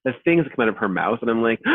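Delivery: normal talking voice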